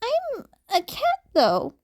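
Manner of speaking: put-on high, timid kid voice; a shy child pointing out the catch; high hesitant little-boy voice; small boy voice. A narrator's reading.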